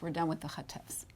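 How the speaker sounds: whispered throwaway aside. Real, not acted. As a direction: breathe it as a quick aside. Aside to class.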